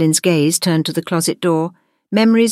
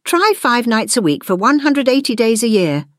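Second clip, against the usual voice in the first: raised, slightly proud one-upping; 6 semitones higher than usual. A narrator's reading. Gwendolyn outdoing the monster's guess, proudly. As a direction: top their guess with a touch of pride. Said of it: "a proud sparkle in her eye".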